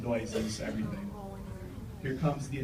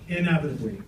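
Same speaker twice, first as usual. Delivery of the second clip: ordinary talk, nothing marked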